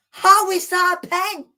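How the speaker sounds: real fan outrage; outraged. Real, not acted. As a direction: demand an answer from the ref. Outrage at penalty.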